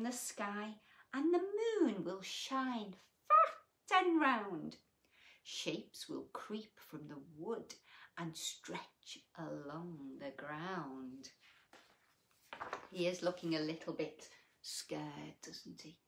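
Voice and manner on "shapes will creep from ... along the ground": plain reading voice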